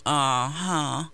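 low, dismissive grunt of doubt; skeptical uh-huh. Pretend. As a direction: grunt it like an unconvinced judge with arms folded. Voicing a disapproving God, arms folded.

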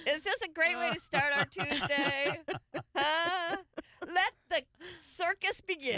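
high, loud, laughing wrap-up; cracking up at her own story. Real, not acted. Host laughing to her co-hosts, high and loud.

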